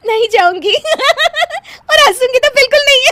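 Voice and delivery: very high, loud giggled vow; promise through laughter. Acted. Wife promising husband not to laugh, laughing. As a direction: swear it while cracking up. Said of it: "तुम तो फिर से हंस दी।".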